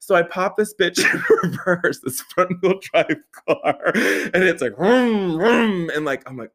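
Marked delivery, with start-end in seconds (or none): 4.8-5.9 high, mouth-made vroom of a spinning engine; engine revving noise. Retold or imitated. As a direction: imitate the car straining to reverse.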